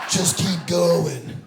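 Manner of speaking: slow, loud push; strained self-urging. Acted. Comic voicing a runner's inner dialogue, strained urging. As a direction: drag each word out forcefully.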